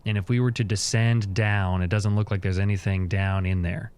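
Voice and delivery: usual speaking voice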